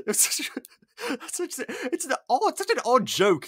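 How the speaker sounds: high, giggly words breaking through laughter; laughing while talking. Real, not acted. Amused host laughing at the odd joke in the scene. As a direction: laugh your way through the line.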